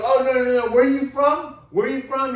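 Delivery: high, loud, insistent demand; confronting the rugby fan about where he is from, yelling; retold yell. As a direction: get in his face with the question.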